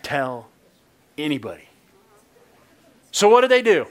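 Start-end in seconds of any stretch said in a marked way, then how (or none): 0.0-1.7 a lightly voiced stern warning. Preacher redoing Jesus's warning, low and stern. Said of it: "I did a pretty good stern voice".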